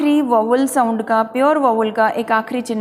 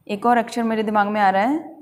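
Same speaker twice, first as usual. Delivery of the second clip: ordinary talk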